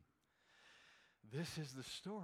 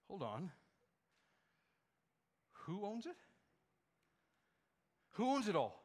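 ordinary delivery

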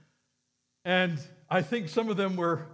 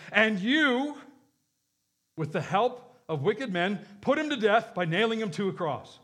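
raised, driving preacher's read; fervent preaching; 2 semitones higher, 4 dB louder than usual. Acted. Preacher reading Peter's words to the congregation, fervent. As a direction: proclaim it to the crowd.

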